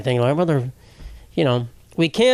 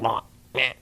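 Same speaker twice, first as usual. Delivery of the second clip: low-key frog call imitation; two soft froggy croaks, the second rising; frog noise; 3 semitones lower, 5 dB quieter than usual. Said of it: "That's a frog noise".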